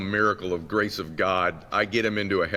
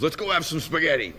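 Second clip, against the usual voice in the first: higher-pitched mock-dad invitation; cheery dad; 8 semitones higher than usual. Dad inviting his son to dinner, light higher voice. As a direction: imitate a dad lightly changing the subject. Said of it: "And he goes".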